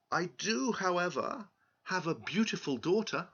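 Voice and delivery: high, pleading farmer voice; pleading old farmer. Acted. Farmer pleads with the king, high and humble. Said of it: "the old farmer asked".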